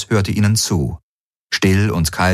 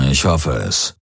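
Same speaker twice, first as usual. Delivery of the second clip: grumbled in a lower voice; low grumble; 5 semitones lower than usual. A narrator's reading. Commander grumbles his doubt to Galen, low grumble. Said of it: "brummte Daraios".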